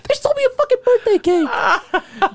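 very high, loud laughing yelp; shocked disbelief. Real, not acted. [name] hearing his cake was stolen, laughing high yell.

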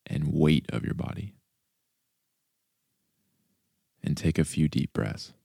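calm body-scan voice with long pauses; guided meditation. Acted. Host leads listeners through a body scan, calm meditation voice. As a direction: guide them softly and unhurriedly through the body.

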